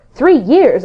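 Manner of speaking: astonished cry. A narrator's reading. Jim cries out at the castaway's news, astonished. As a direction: exclaim it in amazement. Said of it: "I cried".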